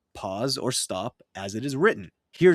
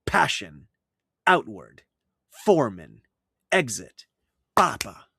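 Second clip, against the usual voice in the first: each word stressed on the first syllable, "PAssion", "OUTward", "FOREman", "EXit", "PApa", louder; trochee examples; 3 semitones lower, 4 dB louder than usual. Shown as a demonstration. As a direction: hit the first syllable of each word, let the second fall away. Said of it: "an inverted iamb is a trochee".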